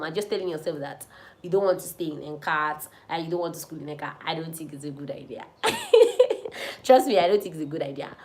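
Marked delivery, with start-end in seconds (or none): 5.5-6.8 short high laugh. Real, not acted. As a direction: laugh high after the point. Laughs at own advice.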